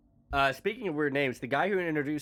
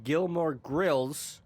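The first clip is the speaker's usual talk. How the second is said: slow punchline; 3 semitones higher, 3 dB louder, much slower than usual. Acted. Punchline wordplay.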